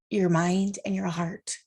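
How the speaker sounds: normal talking voice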